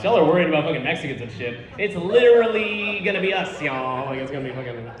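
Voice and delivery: ordinary talk, nothing marked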